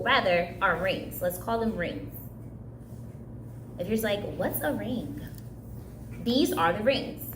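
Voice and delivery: ordinary talk, nothing marked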